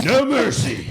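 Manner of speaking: high giggling taunt; laughing. Real, not acted.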